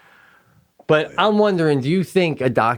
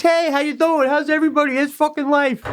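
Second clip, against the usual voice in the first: very high, over-perky greeting; hammed-up chirpy hello held high; Pete, perky. Perky man greeting everybody, exaggeratedly cheery. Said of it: "Pete's always like this".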